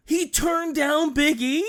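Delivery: high, slow disbelieving question; incredulous. Real, not acted. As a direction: react in astonishment to the refusal. Listener shocked by the refusal.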